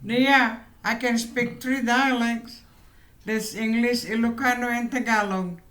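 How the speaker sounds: ordinary talk, nothing marked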